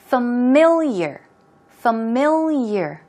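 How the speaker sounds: familiar said slowly, three syllables, stress on mil; model pronunciation. Shown as a demonstration. Teacher repeats familiar slowly for students to copy. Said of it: "let's try it two more times".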